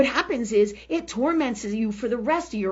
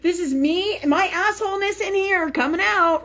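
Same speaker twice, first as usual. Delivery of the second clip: normal talking voice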